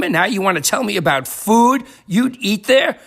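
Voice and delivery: ordinary talk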